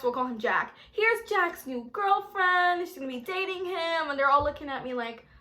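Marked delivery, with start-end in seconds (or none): 0.9-4.1 excited introduction. Retold or imitated. Mom presenting son's girlfriend to family, excited. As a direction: announce her to the relatives.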